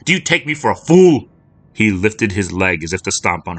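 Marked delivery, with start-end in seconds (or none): 0.1-1.3 much higher, louder enraged shout; angry man yelling at the fallen girl; furious shout. Acted. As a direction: explode at her.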